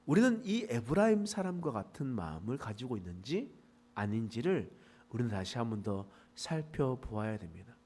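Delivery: ordinary talk, nothing marked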